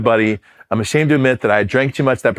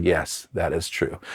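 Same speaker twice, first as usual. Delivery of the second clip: slow, quiet, lower mock-solemn yes; tipsy deadpan lie; 5 semitones lower, 9 dB quieter, much slower than usual. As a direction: drop low and quiet, straight-faced fib. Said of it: "I had drank enough that I was like".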